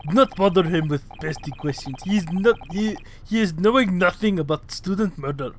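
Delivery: gruff Russian sailor voice; Russian accent. Acted. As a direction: brush them off in a Russian accent. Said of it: "just do Stroganoff voice".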